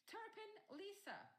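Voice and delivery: high, loud roll call; calling names. Acted. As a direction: call each name out across the hall.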